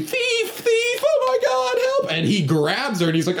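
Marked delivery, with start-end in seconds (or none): 0.0-2.1 very high, panicked mock scream. Blind woman who bumped into a stranger, mock screaming. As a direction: scream for help in falsetto, acted out. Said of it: "immediately starts screaming".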